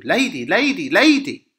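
high, loud sing-out that builds on each call; calling to wake someone. Acted. Nurse trying to wake Juliet, insistent loud calling. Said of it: "she's trying to wake her up".

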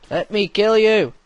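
ordinary talk, nothing marked

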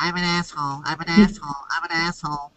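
softly repeated with nose pinched; holding his nose. Retold or imitated. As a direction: chant it three times, nose held. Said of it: "hold your nose like this and say".

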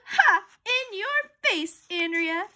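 high, drawn-out taunt; gloating. A narrator's reading. Boy to his quiz rival, gloating. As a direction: crow over your rival, slowly.

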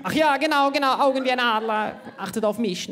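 a blind man who can't read his notes; squinting Himmler. Retold or imitated. Short-sighted Himmler failing to read his speech at the rally, high and fumbling. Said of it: "Auf einmal siehst du".

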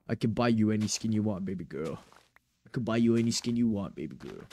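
very deep, slow flirty drawl; soft lazy flirty drawl.

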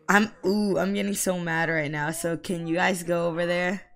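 ordinary talk, nothing marked

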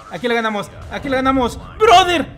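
building to a loud high call; excited shout. Real, not acted. Gamer calling to teammate, rising to a shout. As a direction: push it up into a shout. Celebrating the win.

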